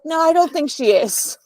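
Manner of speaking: high, giggly "I don't think she is"; amused denial to a friend, laughing it off. Retold or imitated. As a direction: brush off the warning through a laugh.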